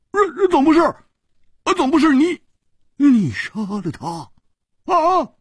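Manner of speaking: high halting blurted question; stammering disbelief. A narrator's reading. Hu Tiehua doubting that Chu killed him, high stammer. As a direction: stumble over it, high and unsure. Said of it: "胡铁花眨着眼睛说".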